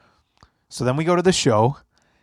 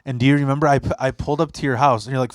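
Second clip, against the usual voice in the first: ordinary talk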